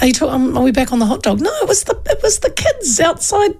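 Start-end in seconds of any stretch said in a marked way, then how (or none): 1.4-3.6 laughing. Real, not acted. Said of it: "Who was I giving that?".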